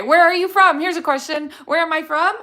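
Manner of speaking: normal talking voice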